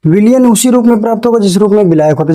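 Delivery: whole sentence in one quick sweep; quick read-back. Shown as a demonstration. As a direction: repeat it fast without pauses. Repeats dictated sentence in a run.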